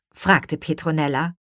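plain reading voice

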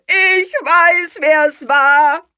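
high, loud, drawn-out proclamation; triumphant blaring shout; trumpeting. A narrator's reading. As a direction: trumpet it to the whole room. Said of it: "trompetete er".

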